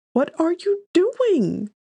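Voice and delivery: put-on high, loud, slow startled cry of a young woman; loud high-pitched exclaim; exclaiming girl. A narrator's reading. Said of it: "she exclaims".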